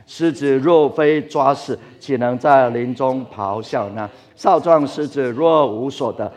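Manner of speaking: slow, loud, measured recitation of scripture; scripture reading aloud. Shown as a demonstration. Pastor leading the congregation in reading the verse, slow and loud. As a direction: read each phrase out slowly and firmly, like leading the church. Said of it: "预备起来".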